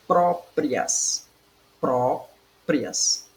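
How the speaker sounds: PRÓ-prias, divided into two syllables; teacher syllabifying "próprias"; split into syllables. Shown as a demonstration. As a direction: say "próprias" in separate beats, PRÓ, then prias. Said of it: "você tem que saber divisão silábica".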